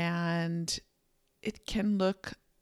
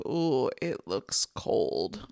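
low reluctant ooh; 6 semitones lower, slower than usual. Retold or imitated. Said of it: "it was also like".

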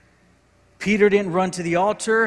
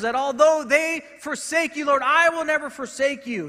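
higher, louder preaching that builds and pushes; impassioned preaching; 6 semitones higher, 4 dB louder than usual. Acted. Preacher pleading over Peter's failure, impassioned. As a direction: drive it home to the congregation.

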